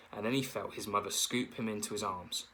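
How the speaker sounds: usual speaking voice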